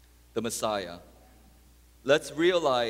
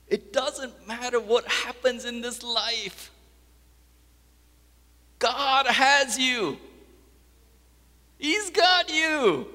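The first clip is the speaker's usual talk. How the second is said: high, slow, stretched-out proclamation; full-force preaching; 12 semitones higher, 7 dB louder, slower than usual. Acted. Preacher assuring the congregation God has them, full-force preaching. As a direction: build to a drawn-out preacher's shout.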